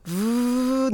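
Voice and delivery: 'ブー' as a long buzzing English V; sustained V sound. Shown as a demonstration. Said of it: "下唇を上の歯で軽く噛む". (「ブー」を下唇を軽く噛んだVの音で長く)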